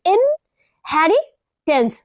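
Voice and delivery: "इनहेरिटेंस" very slowly in parts, in... heri... tance; split into syllables. Shown as a demonstration. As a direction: break "इनहेरिटेंस" into three chunks with clear pauses. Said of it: "बोलते किस तरह से". ("इनहेरिटेंस" धीरे-धीरे, इन-हेरि-टेंस तोड़कर)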